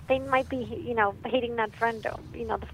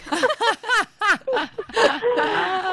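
high, loud burst of laughter; 12 semitones higher, 8 dB louder than usual. Real, not acted. Storyteller cracks up at dad's blunt remark, laughing loudly. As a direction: laugh hard at the punchline. Laughs after her own punchline.

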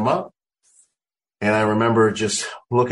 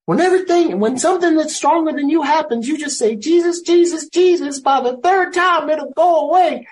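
put-on high, loud old grandma giving advice; grandma's voice; 4 dB louder than usual. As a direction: speak it the way his old grandmother would. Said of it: "she would always say".

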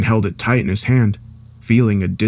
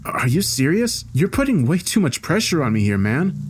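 strained objection, higher than usual; pressured employee; 7 semitones higher than usual. Acted. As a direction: protest at the pressure, pitched high. Voices Tom, pushed by his boss.

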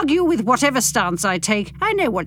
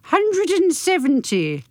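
dramatic announcement; 8 semitones higher, slower than usual. Shown as a demonstration. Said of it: "This is where you put the music in".

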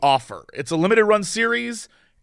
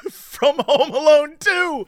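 higher, slow laughing quote; mock proud recall; 8 semitones higher, slower than usual. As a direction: mimic a friend naming the film proudly. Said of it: "And you go".

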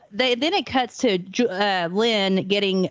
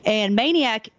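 ordinary talk, nothing marked

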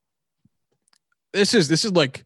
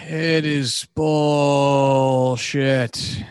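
very slow, stretched-out verdict; long, weary, emphatic condemnation; drawn-out disgust; 3 semitones lower, much slower than usual. Real, not acted. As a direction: drag the vowels out in disgust.

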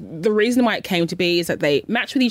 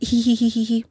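higher-pitched mocking "hee hee" giggle; smug snicker imitation; 4 semitones higher than usual. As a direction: mimic someone smugly tittering. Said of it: "smiling and looking so smug".

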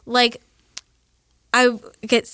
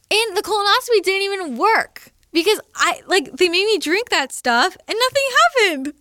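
high, exasperated retelling; indignant, pitched well up; pissed off; 8 semitones higher than usual. Retold or imitated. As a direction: vent in high-pitched frustration. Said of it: "I was so pissed".

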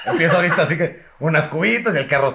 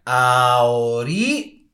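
"Ahorita" hugely stretched, breaking before the last syllable; long drawn-out pause; 4 semitones lower, much slower than usual. Retold or imitated. Parent ordering child home, "Ahorita" very slow and drawn out. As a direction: drag out "Ahorita" like a threatening parent. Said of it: "después viene otra pausa larga".

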